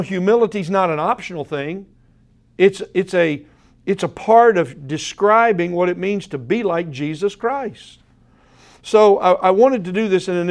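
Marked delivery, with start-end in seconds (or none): none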